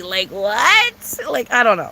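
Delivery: ordinary talk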